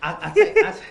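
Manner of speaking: laughing through the words; can't stop chuckling while confessing; real laughter breaking up the words. Real, not acted. Laughs while admitting lie.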